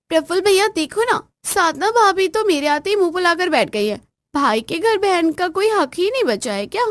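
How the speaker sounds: high, loud plaintive complaint; whiny sister. Acted. Sister complaining to her brother about his wife, high whiny voice. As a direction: play the wronged sister.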